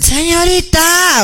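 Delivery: drawn-out, high call for attention. Retold or imitated. Worker calls down for the señorita, calling out. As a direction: call it high, stretching the last syllable.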